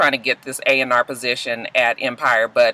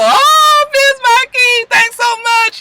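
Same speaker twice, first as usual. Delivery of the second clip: very high, loud delighted squeal; gushing falsetto; 6 dB louder, much slower than usual. Retold or imitated. [name] thanking [name], squealing falsetto.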